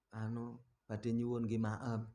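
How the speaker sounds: soft, low, timid begging voice; humble beggar's plea. Retold or imitated. Beggar at the door asking for food, soft and timid. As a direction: ask meekly for food like a poor beggar.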